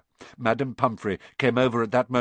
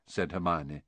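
ordinary delivery

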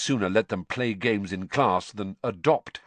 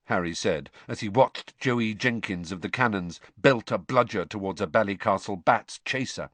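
ordinary delivery

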